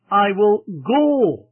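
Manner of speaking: slow, with "go" lifted, drawn out and falling at the end; stress on GO. Shown as a demonstration. Preacher showing the decision looked ahead, stress on "go". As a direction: build to "go" and hold it long. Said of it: "But then it was prospective".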